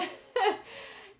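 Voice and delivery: a short high laugh, fading off; fond laugh. Real, not acted. Recalling her favourite college roommate, a quick high laugh. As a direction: laugh warmly at the memory.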